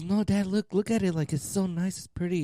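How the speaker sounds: mock eager son. Son urging dad to look at the plant, pleading. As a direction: coax dad to admire it, eager. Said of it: "He goes".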